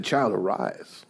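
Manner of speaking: a hint of Jesus speaking gently to the dead girl; gentle command. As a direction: speak it softly and slowly, as a tender command.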